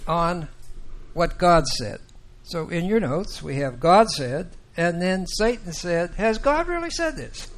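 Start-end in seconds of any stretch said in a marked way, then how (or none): 6.1-7.5 high-pitched, incredulous questioning; casting doubt. Acted. Satan talking to Eve about what God said, doubting. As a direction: question it with a high, sceptical lilt. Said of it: "he casts doubt".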